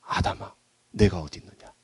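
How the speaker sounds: very soft, low searching question; hushed tender appeal; gentle, non-accusing call. Acted. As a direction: call quietly, with no blame at all. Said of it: "그러지 않았습니다".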